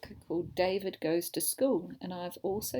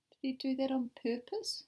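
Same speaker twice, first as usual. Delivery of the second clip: ordinary delivery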